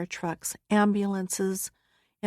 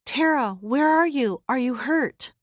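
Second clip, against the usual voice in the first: higher, urgent questions; mother calling out to her daughter, higher and anxious; frantic mother; 4 semitones higher, 3 dB louder than usual. Acted. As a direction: demand to know she's safe.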